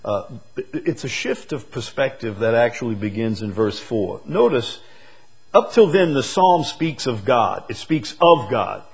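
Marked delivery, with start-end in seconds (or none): 4.2-8.8 rising preacher's voice, louder; preaching. Acted. Preacher lifting his voice to the congregation. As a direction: preach it out with force.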